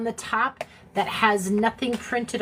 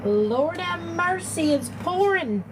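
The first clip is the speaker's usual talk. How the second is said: excited exclamation; 8 semitones higher than usual. Real, not acted. Exclaiming at heavy rain.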